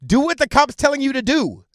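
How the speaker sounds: loud, high-pitched barked order; stern command. Acted. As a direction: shout her down like a man laying down the law. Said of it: "needs some man in her life to say".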